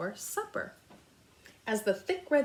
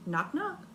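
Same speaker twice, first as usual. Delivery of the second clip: higher knock knock; 6 semitones higher than usual. A narrator's reading. Narrator acting the knock at Amu's door, higher. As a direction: rap it out like a door. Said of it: "someone was at the door".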